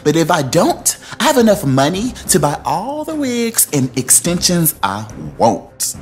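mock indignant read. Reading Nene's angry text.

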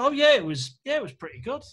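high-pitched, noncommittal approval; unconvincing praise. Retold or imitated. Runner giving the screenwriter his lukewarm verdict, unconvincing high-pitched praise.

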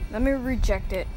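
ordinary delivery

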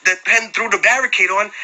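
ordinary talk, nothing marked

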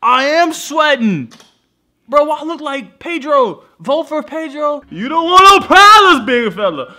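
tipsy loud rambling. Real, not acted. Drunk, shouting at camera.

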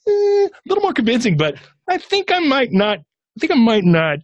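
high-pitched, hesitant mock squirming; squeaky, doubtful stalling; reluctant hedging. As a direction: wheedle your way out in a comically high voice. Acting out his reluctance to let go.

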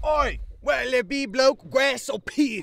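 high, slow, hammed-up British geezer accent; over-the-top mock British accent; bad put-on British accent. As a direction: badly fake a British dealer's accent. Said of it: "I thought we were doing accents".

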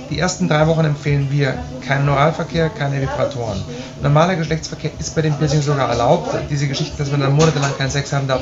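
normal talking voice

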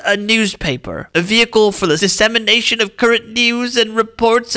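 put-on high, wavering dying old man; old-timey voice. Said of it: "old-timey voice or dying old man voice".

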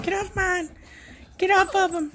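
high put-on dog voice, bossy; voicing the mama dog. Owner voicing the mama dog shoving a pup away in a high voice. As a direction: squeak it out like a cranky mama dog. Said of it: "She's like".

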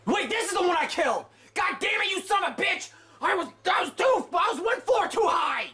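high, fast exasperated yelling; real annoyed gamer yell; frustrated shout. Real, not acted. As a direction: shout it out at your own blunder. Realises mistake, shouting.

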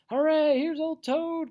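high, loud, drawn-out cheer; delighted cry. A narrator's reading. Mole greeting his returned friend Toad, delighted cry. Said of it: "cried the mole".

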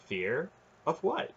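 surprised echo. Acted. Garion echoing the knight in surprise, rising. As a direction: echo the word, puzzled and rising.

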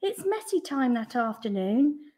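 ordinary delivery, nothing marked

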